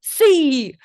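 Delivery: high loud Si; hammed-up taunting Si; mock Spanish yell. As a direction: fire back a sharp Si. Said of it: "she goes".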